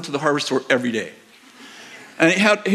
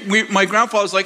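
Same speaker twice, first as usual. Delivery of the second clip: ordinary talk, nothing marked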